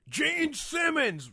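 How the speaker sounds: mock heckler, slow and high; drawn-out heckler shout. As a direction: stretch the name like a fan.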